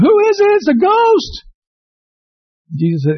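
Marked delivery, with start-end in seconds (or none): 0.0-1.4 high, panicked cry of terror; frightened disciples shrieking in the boat. A narrator's reading. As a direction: cry out as if seeing a ghost. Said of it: "They cried out".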